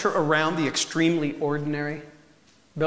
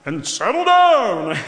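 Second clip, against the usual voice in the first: laughing God voice; 3 semitones higher, 7 dB louder, slower than usual. Shown as a demonstration. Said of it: "I just sound more like Noah".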